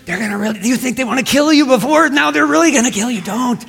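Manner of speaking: high, fast, frantic warning; hammed-up terror of a worried follower; panicked disciple. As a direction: blurt it out in rising alarm. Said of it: "I'm like".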